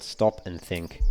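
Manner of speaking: normal talking voice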